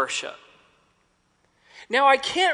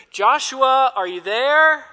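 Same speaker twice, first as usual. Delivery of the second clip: louder, higher call across fog; 5 semitones higher, 6 dB louder than usual. Retold or imitated. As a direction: call out into the mist. Guide calling names in fog.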